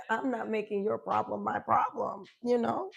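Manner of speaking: ordinary talk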